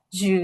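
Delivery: ঝু with the tongue drawn back to the palate; back-tongue sound. Shown as a demonstration. Teacher contrasts two sounds, ঝু made on the palate. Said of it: "জিহ্বাটা পিছনের দিকের তালুর সাথে লাগছে".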